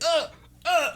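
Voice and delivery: high, pinched choking grunts; short falsetto yelps of discomfort; strained grunts. Acted. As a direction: grunt high as if stuck by the neck. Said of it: "as he's like".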